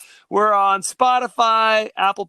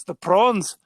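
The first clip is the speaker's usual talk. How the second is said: a mock South African accent on prawns; lightly put-on South African accent; much slower than usual. Podcast host mocking District 9 aliens in a South African accent. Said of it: "That's my South African accent".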